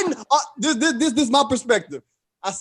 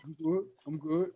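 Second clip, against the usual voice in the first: low-key, much softer mumble; imitated dazed reassurance; 4 semitones lower, 13 dB quieter than usual. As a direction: play down the hurt, very softly. Imitates dazed friend walking off.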